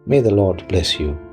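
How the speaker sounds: normal talking voice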